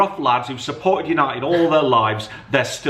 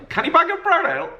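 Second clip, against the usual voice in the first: put-on Geordie accent, pitched much higher and rising like a question. Dad repeats the Geordie order as a high, squeaky question. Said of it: "I'm not gonna do my Geordie accent".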